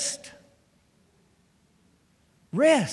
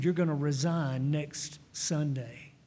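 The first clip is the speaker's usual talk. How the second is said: low, softer and slow; grave retold answer; 7 semitones lower, 6 dB quieter, slower than usual. The Lord telling the pastor to resign, grave and quiet. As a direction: hand down the verdict slowly and quietly.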